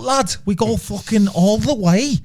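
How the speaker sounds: put-on over-the-top Scouse girl boasting; Scouse accent. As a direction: ham up a Scouser from Liverpool. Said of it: "I think I'm all right at Scouse".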